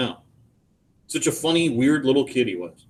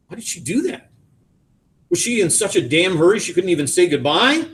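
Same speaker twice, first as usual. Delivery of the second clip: higher-pitched, bitter questioning; 7 semitones higher than usual. A narrator's reading. Son asks father why mother left without goodbye, bitter. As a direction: bitterly demand an explanation. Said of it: "His voice is bitter".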